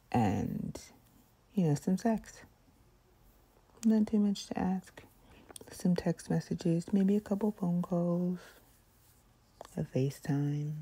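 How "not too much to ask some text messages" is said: whisper. Real, not acted. ASMR whisper rant.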